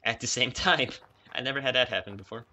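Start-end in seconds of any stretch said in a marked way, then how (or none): none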